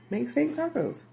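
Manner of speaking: steak tacos sung out high and excited. Real, not acted. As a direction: pitch it way up with glee. Punchline answer to own question.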